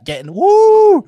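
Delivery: mock cheer.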